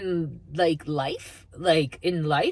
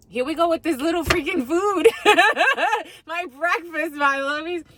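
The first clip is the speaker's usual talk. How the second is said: high, laughing aside; giggly breakfast aside; 10 semitones higher, 3 dB louder than usual. Real, not acted. The psychic laughing to viewers about breakfast, high and giggly. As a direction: squeal about the food.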